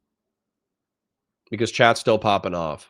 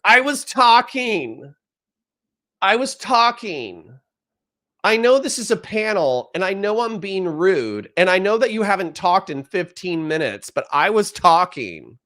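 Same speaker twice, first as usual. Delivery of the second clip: mocking whiny panelist; 9 semitones higher than usual. Streamer mocking a debate panelist, high whiny voice. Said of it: "they were like".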